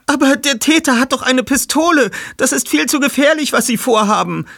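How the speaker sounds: hohe, ängstliche Frauenstimme; nervös. Acted. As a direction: besorgt warnen. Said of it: "sehr nervösen Eindruck". (junge Frau warnt nervös vor der Pistole)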